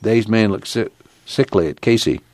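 plain reading voice

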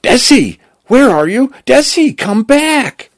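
high, panicked cry, somewhat restrained; screaming. A narrator's reading. Cal screaming after Desi as she is dragged away, high-pitched cries. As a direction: call out desperately at the top of your pitch. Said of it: "Cal screaming".

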